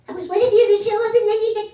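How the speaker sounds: squeaky, fast, loud high-frequency voice; very high pitch. Retold or imitated. Son talking so high dad can't understand him, fast and squeaky. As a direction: squeal it way up, too high to follow. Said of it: "He's talking in frequency so high".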